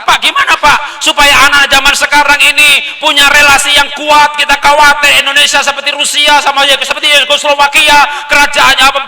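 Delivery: high, loud, driving sermon voice; rally the hall like a preacher; impassioned preaching. Acted. Speaker urging parents on national unity, preaching at full force.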